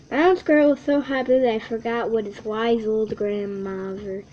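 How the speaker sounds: ordinary delivery, nothing marked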